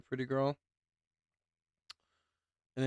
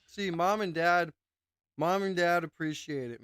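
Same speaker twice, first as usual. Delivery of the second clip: sarcastic; 4 semitones higher, 5 dB louder than usual. Real, not acted. Mocking parents' praise on stream.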